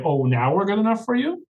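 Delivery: incredulous question climbing high at the end; mock indignant comeback. Church members answer the newcomers back, mock indignant comeback. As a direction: throw it back as an affronted, rising question.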